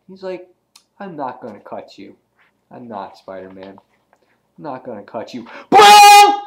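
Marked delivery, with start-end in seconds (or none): 1.0-5.4 low, soft, deliberate killer; quietly menacing character voice; Deadpool. Acted. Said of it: "And then Deadpool's like". 5.7-6.4 sudden shouted blast noise; imitated gunshot boom; explosive boom.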